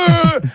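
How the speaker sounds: high-pitched mock yell; wordless high holler, playfully imitated; imitated fan yell. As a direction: mimic a yelling Italian fan. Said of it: "j'ai un Italien qui fait".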